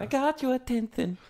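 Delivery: high lisping singer voice. Retold or imitated. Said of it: "he has a lisp".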